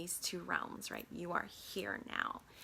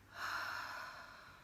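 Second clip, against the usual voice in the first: exhaling sigh; 4 dB quieter than usual. Shown as a demonstration. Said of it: "out through our mouth with a sigh".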